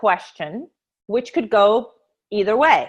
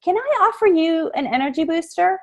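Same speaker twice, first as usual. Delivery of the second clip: Can I offer you an energy booster? pitched higher with a lifted, rising end; bright sales pitch; 7 semitones higher than usual. Shown as a demonstration. Saleswoman greeting a passer-by at her table, pitched higher and rising. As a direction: sing out the offer high and inviting. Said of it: "and you say".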